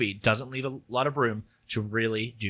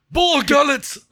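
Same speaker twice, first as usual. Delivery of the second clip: mock Saw voice; 13 semitones higher, 7 dB louder, slower than usual. Mocking the impression.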